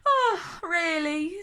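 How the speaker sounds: bored yawn through the words. Acted. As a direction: say it mid-yawn, unimpressed.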